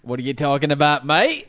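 mock Aussie, ending high and loud on "mate"; Australian rising inflection. Shown as a demonstration. Co-host tries the listener's tip, Australian accent with rising end. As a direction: send "mate" shooting up at the end, Australian style. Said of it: "on a high note".

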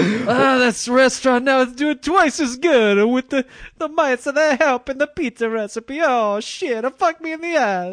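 high, loud whining owner voice; cartoonish squeaky complaint from the owner; mock restaurant owner. Mocking the restaurant owner's lament.

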